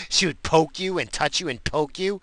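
ordinary talk

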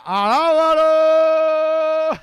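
loud, high, long-held shout; imitated preacher's holler; drawn-out praise call. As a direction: stretch the praise word out as a big call.

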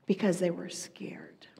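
hushed whisper on the fear. Acted. Preacher whispering to the congregation. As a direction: drop to a breathy whisper.

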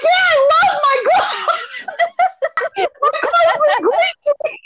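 high, squealing through real laughter; cracking up. Real, not acted. Teller mocking her own car answer, helpless laughter. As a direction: re-enact the quote while losing it laughing.

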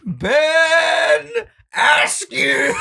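high, loud ring announcement; announcer call. Pretend. Calling out the fighter like a ring announcer, loud and high. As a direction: boom out the fighter's name.

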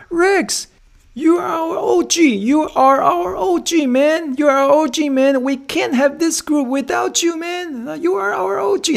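very high, slow, stretched hype shout; hyped-up call out. Retold or imitated. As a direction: cheer him on like a buddy, way up high. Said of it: "有一个人就直接大喊".

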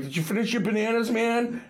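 mock-taunting banter; teasing heckle. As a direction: imitate buddies needling a friend, fast. Buddies busting his balls.

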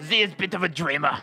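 put-on a German accent drifting into Scottish brogue; German accent that slips Scottish; mock German accent. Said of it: "That actually sounds a bit Scottish".

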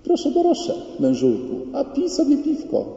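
very high, sugary wifely coaxing; imitated fake-sweet high voice; mock sweetness. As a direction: coo at a husband while hiding inner fury. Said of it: "Ja ci złego słowa nie powiem, a w środku".

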